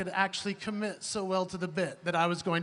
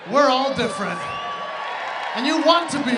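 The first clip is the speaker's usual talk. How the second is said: louder shouted rally to the crowd; 3 semitones higher, 8 dB louder than usual. Real, not acted. Comic rallies the audience with a shout. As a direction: pump up the audience. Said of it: "rallying the crowd".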